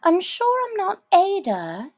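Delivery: put-on high girlish thinking aloud; lightly voiced Alice planning; Alice musing. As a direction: puzzle it out like a child.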